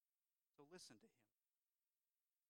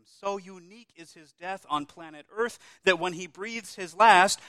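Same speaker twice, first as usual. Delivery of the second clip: louder and louder preaching build; full-force preaching; 4 semitones higher, 13 dB louder than usual. Acted. Preacher to congregation on Christ's death, swelling preaching. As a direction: swell the voice toward the climax.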